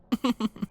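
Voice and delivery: soft high titter; quiet titter. Acted. Tukey titters quietly at Diana's scolding. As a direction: titter quietly under the breath. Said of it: "Tukey tittered quietly".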